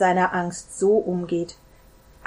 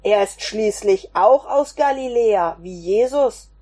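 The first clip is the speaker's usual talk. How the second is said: louder, a servant voice pressing the point; insistent call; 4 dB louder than usual. Acted. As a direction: push the argument loudly. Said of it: "rief einer von ihnen". (eindringlich und laut)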